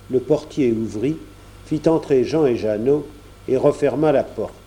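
ordinary delivery, nothing marked

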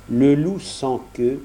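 usual speaking voice

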